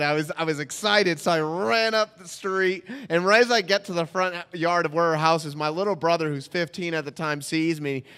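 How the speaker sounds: ordinary talk, nothing marked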